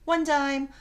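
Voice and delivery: plain reading voice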